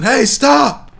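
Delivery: mock desperate husband shouting; imagined yell. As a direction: yell at the hippo to stop. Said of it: "You think he just started yelling".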